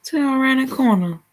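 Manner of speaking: playing God shrugging it off; God's voice, mock. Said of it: "Sometimes he be like".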